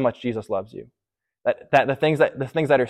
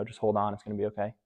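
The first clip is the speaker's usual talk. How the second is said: softer mock reassurance; hushed empty advice; 3 semitones lower, 11 dB quieter, faster than usual. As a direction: offer soft hollow comfort, almost under the breath. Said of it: "the advice they give".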